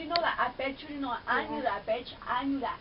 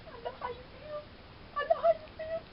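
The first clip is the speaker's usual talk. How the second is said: high, tearful, breaking into a sob; crying along; 13 semitones higher, faster than usual. Real, not acted. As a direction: sob it out in sympathy. Crying with character.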